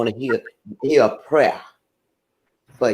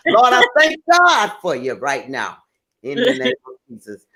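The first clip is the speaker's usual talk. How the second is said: prayer; 9 semitones higher than usual. Acted. Praying aloud.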